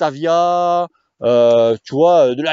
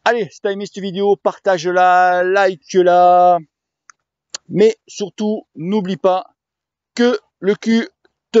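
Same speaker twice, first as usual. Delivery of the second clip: outro plea; 3 semitones higher than usual. Real, not acted. Drawn-out outro call to action.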